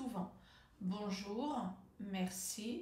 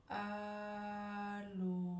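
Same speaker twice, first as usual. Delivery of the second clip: ALLÔ very slow, both vowels held on a level note; stretched syllables. Shown as a demonstration. Coach practises the famous telephone word, drawn-out ALLÔ. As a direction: hold the A long, then a long steady LÔ. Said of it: "allonger le mot, allonger la syllabe".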